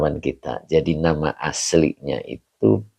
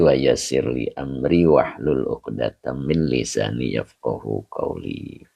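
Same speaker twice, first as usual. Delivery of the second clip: opening prayer; 4 semitones lower than usual. Acted. Teacher opening the online study session for viewers, recited prayer.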